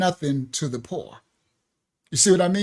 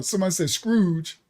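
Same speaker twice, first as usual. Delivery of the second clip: normal talking voice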